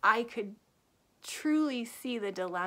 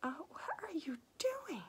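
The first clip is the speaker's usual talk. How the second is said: acted-out aghast reaction, half under the breath; appalled disbelief; 8 semitones higher, 4 dB quieter than usual. Reader talking to the hero the whole time, appalled. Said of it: "the whole time I'm going".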